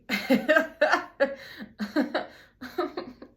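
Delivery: loud, high burst of laughter; long helpless laugh that tapers off; cracking up. Real, not acted. Storyteller not ready for the vow, cracking up.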